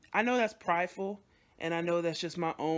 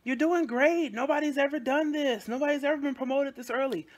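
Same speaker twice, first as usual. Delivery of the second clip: higher, louder mock praise; mock cheerful coworkers; 8 semitones higher, 5 dB louder than usual. Coworkers reassuring her about the promotion, mock cheerful. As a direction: parrot the bosses' upbeat praise, pitched up. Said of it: "they all be like".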